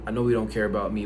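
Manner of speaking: ordinary talk, nothing marked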